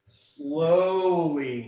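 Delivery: loud, stretched-out word; drawn-out suspense. A narrator's reading. Game master narrating the dragon's approach, drawn-out suspense. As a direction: draw it out to match the creeping dragon.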